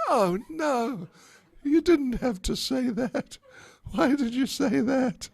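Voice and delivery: high, worried inner cry; wincing at her friend's wild excuse; dismayed Radharani. Acted. Radharani silently dreads Lalita's raining-flutes excuse, high and worried. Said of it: "Radharani thought".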